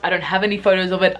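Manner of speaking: ordinary delivery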